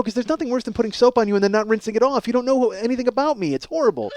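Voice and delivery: ordinary talk, nothing marked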